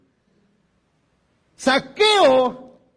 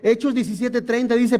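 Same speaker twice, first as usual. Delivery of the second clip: normal talking voice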